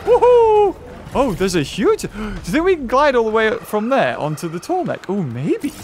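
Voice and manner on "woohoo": high, loud gleeful whoops; playful whooping. Pretend. Gamer flung about in play, high whooping. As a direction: whoop like you're on a fairground ride. Player launched into the air.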